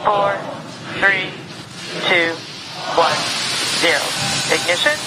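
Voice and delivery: countdown over the radio loop; crisp radio callout, high and steady; mission control radio. Real, not acted.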